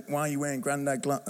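put-on botched Kiwi accent, Manchester-sounding. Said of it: "That's a Manchester accent. I can't do a Kiwi one".

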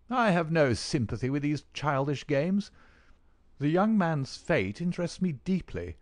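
put-on a disdainful older doctor; stern doctor voice. Doctor scorns the football.

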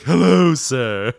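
prim, loud giraffe butler greeting. Shown as a demonstration. Giraffe butler greeting a gentleman, prim and loud. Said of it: "I always think of a Giraffe Butler".

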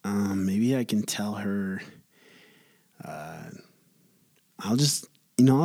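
ordinary talk, nothing marked

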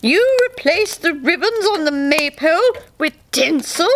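mild mock-indignant May Queen. A narrator's reading. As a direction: demand an answer in outrage. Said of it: "she spat".

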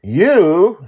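put-on high, loud child voice; pointing girl. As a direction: call her out while pointing. Joy miming instructions.

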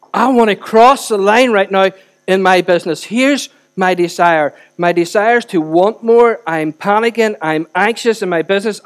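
loud, urgent mock confession to God; impassioned prayer. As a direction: pour it out forcefully, hitting key words. Acts out a desperate, honest prayer.

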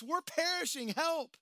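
high, loud plea for rescue; panicked cry for help. Retold or imitated. Preacher voicing disciples pleading for help, high panicked yell. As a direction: yell for help over the waves. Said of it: "They're just stating the facts".

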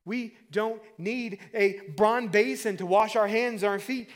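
loud, high, building sermon delivery; driving pulpit proclamation; impassioned preaching. Real, not acted. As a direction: preach with rising force to the climax. Preaches at full force.

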